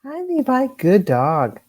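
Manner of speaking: high, sing-song pet talk; talking to a dog. Real, not acted. Greeting the dog in studio.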